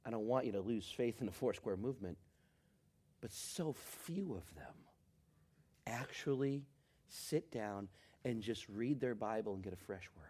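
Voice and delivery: ordinary talk, nothing marked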